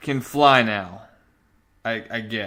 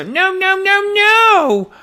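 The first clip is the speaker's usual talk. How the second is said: much higher, whiny cries of no; mock tantrum; 4 dB louder, slower than usual. Comic book reviewer mimics the hero's midnight tantrum, high and whiny. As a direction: ham up a kid throwing a fit. Hero throwing a fit at midnight.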